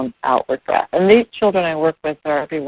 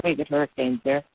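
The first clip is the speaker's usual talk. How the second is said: usual speaking voice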